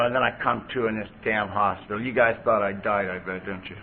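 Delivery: usual speaking voice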